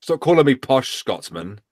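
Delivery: ordinary talk, nothing marked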